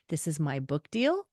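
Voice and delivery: ordinary talk, nothing marked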